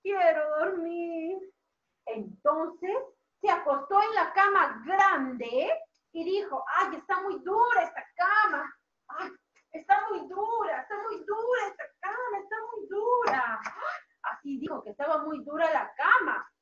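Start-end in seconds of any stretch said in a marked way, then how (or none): 0.0-1.4 slow, drawn-out sleepy whine; sleepy Goldilocks. Acted. Teacher voices Ricitos wanting to sleep, slow and drowsy. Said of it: "Ricitos de Oro quiso dormir". 6.7-13.6 higher-pitched, play-acted whine; complaining Goldilocks. Teacher voices Ricitos on the hard bed, whining.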